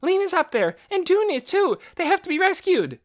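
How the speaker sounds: high, loud urgent voice; urgent shouting. A narrator's reading. Boy jumping in front of housekeeper, shouting. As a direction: insist they must go now.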